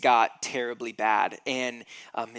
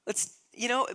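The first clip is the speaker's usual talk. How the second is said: a hint of frantic, high-pitched impatience; lightly voiced Jairus pleading to hurry; impatient father; 11 semitones higher, 4 dB louder than usual. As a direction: Jairus pressing Jesus to hurry, mildly frantic. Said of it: "what's happening with Jesus and Jairus".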